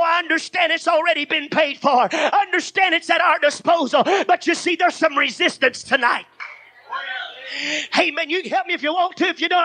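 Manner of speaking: normal talking voice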